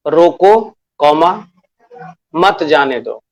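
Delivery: रोको set apart, then मत जाने दो as one falling phrase; pause after रोको. Shown as a demonstration. Teacher shows children the first line, pause after रोको. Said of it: "पहली line है".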